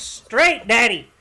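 loud, high mock holler; imitated yelling. Son to his dad backing the boat, imitated yelling. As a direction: shout it up high like the fed-up country son. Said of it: "yelling at his eighty-year-old dad".

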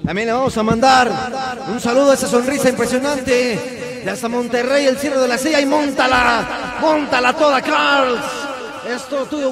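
loud, high hype shoutout; shouting radio host. Acted. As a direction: yell it like a party DJ.

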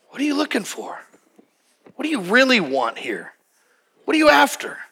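an open, caring question; gentle asking. Shown as a demonstration. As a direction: invite the answer tenderly. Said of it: "I'm sure he didn't say it with that stank on it".

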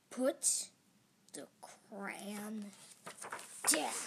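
slow, word-by-word monster growl. A narrator's reading. Monster ordering child to stop coloring, growling. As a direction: growl each word separately. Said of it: "as he growled".

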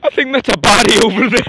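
mock alarm. Vlogger to friend parodying a grim discovery, mock alarm. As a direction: exclaim in hammed-up horror.

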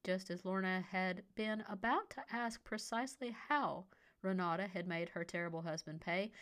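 usual speaking voice

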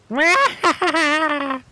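high witch cackle; wicked laugh. A narrator's reading. Witch gloating over the children, high cackle. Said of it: "she laughed wickedly".

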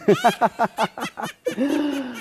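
high cackling laugh of a sea witch; evil witch cackle. Acted. The sea witch gloats over Ariel with a wicked cackle.

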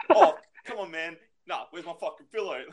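a sudden high, loud burst of mock anger, then fading; mock angry outburst. Friend losing temper, throwing bags.

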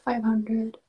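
usual speaking voice

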